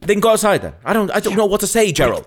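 exasperated. Retold or imitated. Exasperated official voice.

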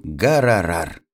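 low mock engine noise; a boy playing at being a car; mock car cry. A narrator's reading. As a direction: lightly imitate an engine, pitched low. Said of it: "и кричал он".

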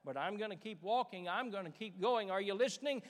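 loud, rising sermon climax; preaching at full force. Acted. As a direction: build the preaching up to a shout.

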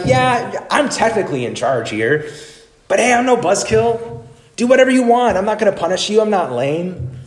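high, breezy mock cool dad. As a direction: play the laid-back dad who lets it slide. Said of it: "he should say something like".

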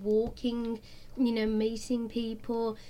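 ordinary talk

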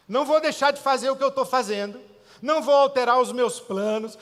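God firmly declaring his plans to Abraham; firm divine declaration. Retold or imitated. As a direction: proclaim it as God who won't change plans. God voiced, firm refusal.

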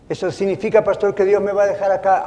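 anxious churchgoer. Acted. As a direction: plead with the pastor nervously. Anxious listener asks the pastor.

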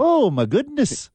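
very high mock-Canadian exclamation; exaggerated Canadian dismay; Canadian accent. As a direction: squeal it like a scandalized polite Canadian. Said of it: "you got a Canadian accent".

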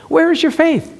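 high, chiding voice of God; preacher voicing God rebuking her; God's voice, chiding. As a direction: scold gently from above. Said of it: "God said".

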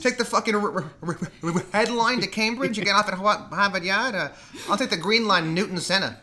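high-pitched Boston transit directions; hammed-up Boston accent. Shown as a demonstration. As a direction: give directions like a sweary Boston local. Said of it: "I can only lock into it".

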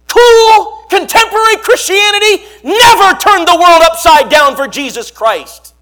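sudden high, loud preacher's cry; preaching shout. Real, not acted. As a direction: blast it out at the congregation. Preacher rails against shallow Christianity.

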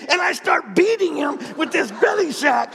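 high, loud shouted build-up; acted shouting rant. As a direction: shout it while flailing away. Acting out a sack beating.

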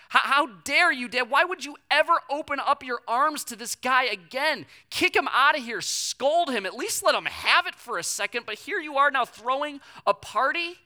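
loud, high indignant outburst at Dad; older son confronting his father, angry and loud. Retold or imitated. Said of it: "probably angry at the father".

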